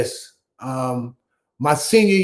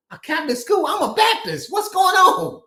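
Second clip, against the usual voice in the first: high-pitched mock incredulity; retold shock; 12 semitones higher than usual. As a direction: squeak out the disbelief, half-laughing. Shocked teen reacting to news.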